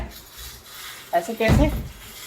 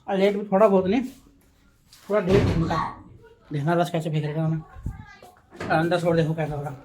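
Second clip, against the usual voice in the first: ordinary delivery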